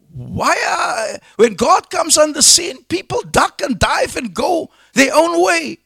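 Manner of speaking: high, impassioned sermon delivery; fervent preaching. Real, not acted. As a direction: preach it at a high, urgent pitch. Preaches at full force.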